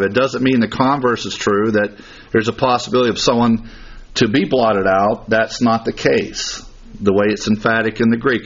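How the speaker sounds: usual speaking voice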